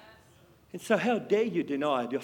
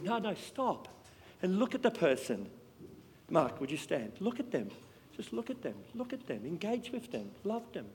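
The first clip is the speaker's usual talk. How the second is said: much softer, close, tender commands; intimate quiet urging; 8 dB quieter than usual. Acted. Preacher asking Mark to stand, then quietly urging the congregation to look at him. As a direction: draw the room in with a hushed voice. Preacher addressing a man nearby.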